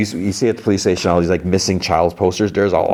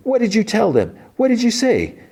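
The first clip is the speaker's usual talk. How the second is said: high, sharp accusing demand; angry demand. Retold or imitated. Ronnie confronting Lily on the stairs, high angry demand. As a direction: confront her, what did you say.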